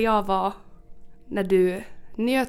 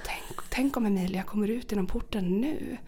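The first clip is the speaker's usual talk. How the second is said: nervous whisper; 6 dB quieter than usual. Retold or imitated. Hiding in a bush, nervous.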